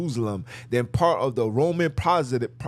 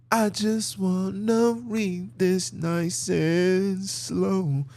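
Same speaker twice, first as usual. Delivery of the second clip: very slow, drawn-out words; slow reading demo; 5 semitones higher, much slower than usual. Shown as a demonstration. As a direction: stretch each word out to show slow reading. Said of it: "You gotta read it slow".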